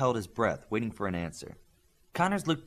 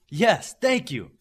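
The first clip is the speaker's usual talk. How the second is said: eager thanks; 9 semitones higher, 7 dB louder, slower than usual. Acted. Eager reply to coach's acceptance.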